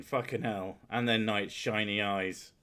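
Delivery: normal talking voice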